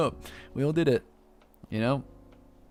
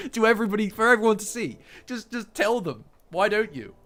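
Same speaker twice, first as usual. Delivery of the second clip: put-on high, whiny tattletale kid voice; mock whiny kid complaining to everyone; whiny little brother; 9 semitones higher, 4 dB louder than usual. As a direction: whine it like a bratty younger sibling. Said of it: "that's the tone".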